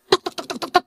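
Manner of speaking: quick, soft, high "tek-tek-tek"; rapid-fire chatter noise. Retold or imitated. Preacher showing how she talks without stopping, rapid chatter. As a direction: imitate nonstop talking with clipped little syllables. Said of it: "Saya bisa ngomong nggak berhenti".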